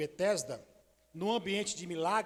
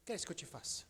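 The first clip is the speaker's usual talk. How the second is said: soft, much quieter tender question; gentle Jesus; 11 dB quieter, faster than usual. Shown as a demonstration. Jesus to the blind man, a soft tender question. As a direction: ask it gently, almost hushed. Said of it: "Olha a mudança de comportamento de, de Jesus e da voz dele".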